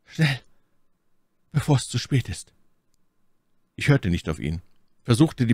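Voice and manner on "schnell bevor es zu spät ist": higher voice, softened, with fear underneath; resigned, anxious. A narrator's reading. Warren urging escape, resigned but anxious. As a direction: urge it with hopeless resignation and a touch of fear. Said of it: "klang er weicher, nach hoffnungsloser Resignation".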